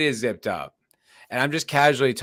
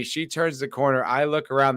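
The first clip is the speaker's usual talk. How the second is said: ordinary talk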